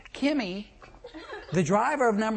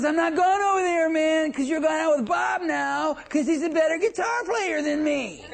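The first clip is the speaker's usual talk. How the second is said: Pete refusing; 8 semitones higher than usual. Retold or imitated. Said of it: "And Pete says".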